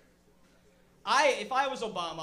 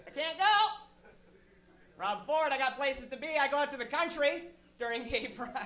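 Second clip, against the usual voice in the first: mock Rob Ford; 5 semitones higher than usual. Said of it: "every year he's like".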